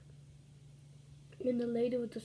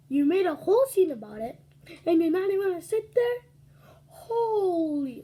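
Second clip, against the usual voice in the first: much louder and higher, exasperated; outraged scolding; 7 semitones higher, 10 dB louder than usual. Retold or imitated. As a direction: call her out in front of everyone. Lady scolds after the scene.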